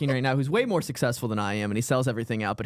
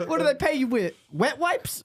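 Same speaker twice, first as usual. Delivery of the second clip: mock comic voice; 11 semitones higher than usual. Mocking the comic together.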